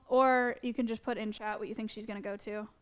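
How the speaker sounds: normal talking voice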